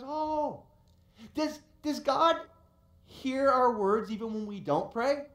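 lightly voiced curious daughter; light child voice. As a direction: daughter asks her dad, lightly voiced little girl. Little daughter voiced in story.

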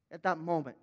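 normal talking voice

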